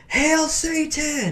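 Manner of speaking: high, drawn-out mock holler; retold taunting yell. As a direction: call it out the window, stretched out. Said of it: "I yelled out her window".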